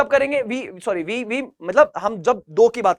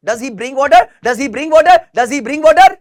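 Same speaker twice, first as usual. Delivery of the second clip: building drill; 3 semitones higher, 7 dB louder, much slower than usual. Shown as a demonstration. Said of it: "teacher repeats drill sentence".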